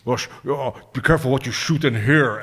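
imitated Scottish screen-actor voice; a lightly done Scottish impression; Sean Connery. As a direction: mimic a Scottish-accented submarine captain. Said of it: "starts mimicking Sean Connery".

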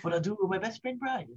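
high, soft cartoon villain baby; Stewie. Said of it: "start using a bunch of Stewie".

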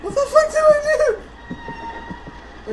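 high-pitched startled question; alarmed outcry. Real, not acted. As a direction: cry out in real alarm. Alarmed by event in game.

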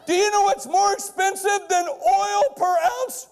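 drawn-out shouted teaser; rising shout. Real, not acted. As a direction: belt it to the back row like a showman. Building up the big reveal.

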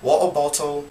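water bottle with glottal t, English accent, no final r; English accent water bottle, T swallowed. Shown as a demonstration. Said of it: "the one without pronouncing the T".

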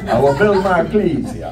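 hammed-up Transylvanian count voice through a laugh; mock Transylvanian accent. Preacher joking ecclesia sounds Transylvanian, mock Transylvanian accent. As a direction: joke the word "ecclesia" in a Transylvanian vampire accent. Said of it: "Sounds kinda like Transylvania".

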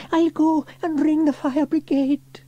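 high-pitched urgent call; girl calling out. A narrator's reading. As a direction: call out in a little girl's voice. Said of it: "she called".

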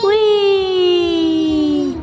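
long, high "whee" squeal; playful whee. Pretend. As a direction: draw out a gleeful high whee. Echoing the child's whee.

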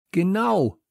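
high, emphatic agreement; called out. A narrator's reading. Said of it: "rief ich".

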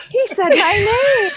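high, loud thrilled squeal; starstruck fan. Retold or imitated. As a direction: gush like a kid whose hero noticed them. Fan thrilled at being named.

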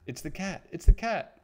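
high cry bursting loud on the repeat; alarmed cry. A narrator's reading. Mouse sees a shadow across their path, rising alarmed cry. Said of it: "cried Squeak".

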